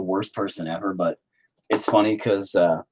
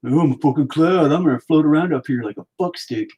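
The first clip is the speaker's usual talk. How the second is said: higher, silly put-on voice for a cloud; mock a smug cloud, pitched up; 5 semitones higher than usual. Mocks the cloud's voice.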